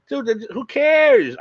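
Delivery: high, slow, loud dismissal; exasperated who-cares; dismissive. Real, not acted. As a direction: brush the critics off, drawn out. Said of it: "dismissing critics".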